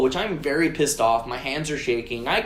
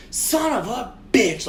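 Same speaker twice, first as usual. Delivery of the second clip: higher, slow retold outburst; loud angry curse; 7 semitones higher, slower than usual. Driver sees the scratched bumpers, retold angry outburst. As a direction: draw out the mock-angry curse. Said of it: "I say it really loud".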